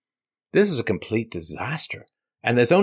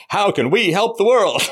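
higher, louder, mock-eager; mock earnest; 8 semitones higher, 4 dB louder than usual. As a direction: play the eager do-gooder.

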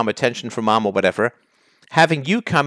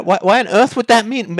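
normal talking voice

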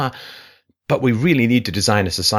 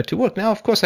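normal talking voice

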